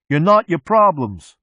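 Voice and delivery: high, slow, loud yell. A narrator's reading. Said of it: "Mechanic yells".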